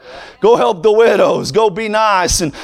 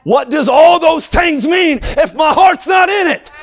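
preaching at full force; 5 semitones higher than usual. Acted. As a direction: build the fire and hammer each phrase.

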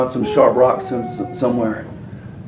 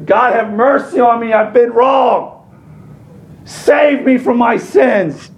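crying out for mercy; 8 semitones higher, slower than usual. Retold or imitated. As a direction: wail it out like a broken sinner begging God. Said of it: "sobs and tears and cries".

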